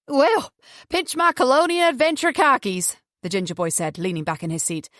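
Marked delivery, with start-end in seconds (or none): 0.1-3.0 high-pitched, cocky Southern US whoop; Southern US accent. A narrator's reading. Ginger boy reacting to a big raise, Southern US accent. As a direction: exclaim in a broad Southern US accent. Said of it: "His southern U S accent".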